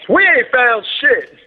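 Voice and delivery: high-pitched, drawn-out comic holler; shouted movie quote. Acted. Podcaster quoting a Spaceballs stormtrooper to co-hosts, high slow holler. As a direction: belt it out slowly like a comedy film line.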